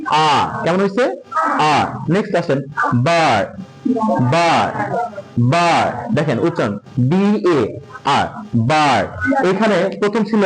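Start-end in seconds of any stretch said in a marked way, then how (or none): 3.0-5.8 বার with an open "aa" vowel; A sounded as আ. Shown as a demonstration. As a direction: model বার as a drill word, the vowel held. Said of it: "বার উচ্চারণ করতে এর উচ্চারণটা".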